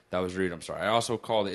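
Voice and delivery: ordinary talk, nothing marked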